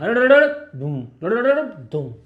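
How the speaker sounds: rolling "drrr" ending in "doom"; vocal sound effect of a wave bursting out; imitated rumble and boom. Shown as a demonstration. Imitates wavefronts forming one after another.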